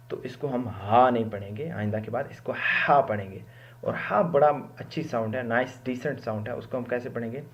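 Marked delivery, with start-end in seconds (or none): none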